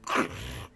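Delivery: rough throat rasp like grinding stone; imitated grinding boulders. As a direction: grind out a guttural rumble of boulders. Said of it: "like, great boulders grinding against each other".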